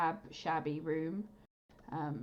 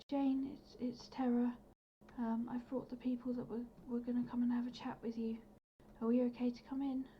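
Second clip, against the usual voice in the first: higher-pitched, polite call through the door; Terra; 6 semitones higher than usual. Acted. Terra asks Jane to let visitors in, higher-pitched character voice. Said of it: "she'll just go".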